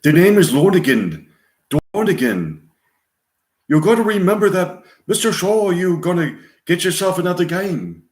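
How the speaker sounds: high-pitched put-on Irish accent. Card player warning Mister Shaw, Irish accent. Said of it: "Irish accent, sitting on a train".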